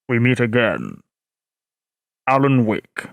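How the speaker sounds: very low, ominous bass; James Earl Jones. Shown as a demonstration. Streamer playing villain to Alan Wake, very deep. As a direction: threaten the hero in a dark rumble. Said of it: "I will do my best to do James Earl Jones".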